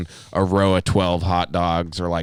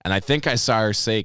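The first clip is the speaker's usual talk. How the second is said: normal talking voice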